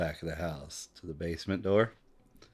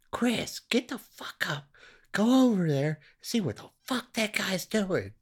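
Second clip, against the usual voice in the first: imitating furious woman. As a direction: ham up an angry wife ordering her man out of bed. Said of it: "just fucking furious".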